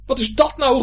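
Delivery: high, loud angry outburst; furious high-pitched call; angry shouting. A narrator's reading. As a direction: yell it crossly. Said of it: "zo verschrikkelijk boos".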